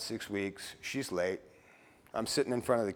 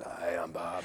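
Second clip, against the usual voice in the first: oxygen mask voice; 4 semitones lower, 4 dB quieter, slower than usual. Retold or imitated. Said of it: "oxygen mask".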